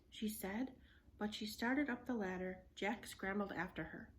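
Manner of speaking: ordinary delivery, nothing marked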